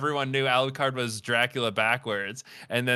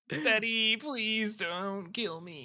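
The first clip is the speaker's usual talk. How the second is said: pleading son; 9 semitones higher, 4 dB quieter, much slower than usual. Pretend.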